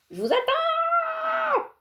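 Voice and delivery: long high drawn call. Acted. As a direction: call across the yard to everyone.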